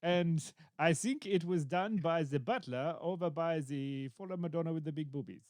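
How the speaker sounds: hammed-up French-accented detective; Hercule Poirot. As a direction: introduce yourself in a silly French accent. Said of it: "Hello, I am Hercule Poirot".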